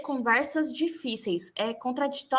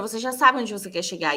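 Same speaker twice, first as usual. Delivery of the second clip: ordinary talk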